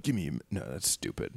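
low, soft practice run, abandoned; rehearsing to oneself. Acted. First-time mugger practising in the mirror, self-consciously. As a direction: try it under your voice, then dismiss it. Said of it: "they run it in the mirror".

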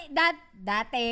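ordinary delivery

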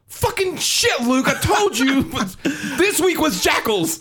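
high, laughing mock scold at Luke; a laughing, high-pitched mock telling-off; exasperated homie. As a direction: squeal it high through laughter, blaming him. Homies scolding clown-mask guy.

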